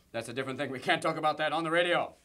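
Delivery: ordinary delivery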